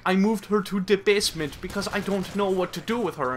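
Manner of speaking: a hint of a helpless movie mom; voiced worried mom. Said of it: "the mom's like".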